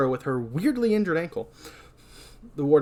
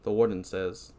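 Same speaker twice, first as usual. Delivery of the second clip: normal talking voice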